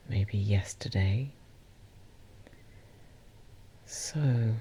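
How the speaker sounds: guided meditation. Acted. Host guiding listeners to call back their energy, guided meditation. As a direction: ease listeners gently inward, leaving space between phrases.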